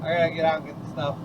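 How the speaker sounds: normal talking voice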